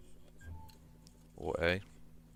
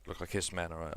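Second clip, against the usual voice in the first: usual speaking voice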